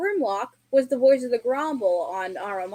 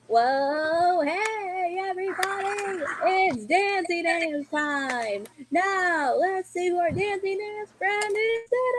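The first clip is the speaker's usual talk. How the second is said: higher, drawn-out cartoon host voice; Plex; 6 semitones higher, slower than usual. A streamer showing off an impression, higher-pitched Plex. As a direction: call out in a high, stretched character voice. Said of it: "I could do a Plex impression as well".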